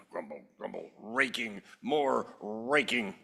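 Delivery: low, soft grouchy grumble; grumbling neighbor. Acted. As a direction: mutter it like a tired grump. Grumbling about raking.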